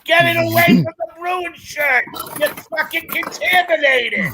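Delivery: hammed-up horrified yell; mock panic shout. Mock horror at rival shirts.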